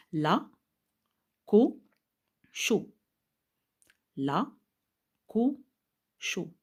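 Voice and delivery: "La", "ko", "cho" each said alone with long pauses; syllable by syllable. Shown as a demonstration. Teacher showing three syllables to order, "La", "ko", "cho" said one by one. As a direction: separate "La", "ko", "cho" as isolated syllables. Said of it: "vous avez ici trois syllabes".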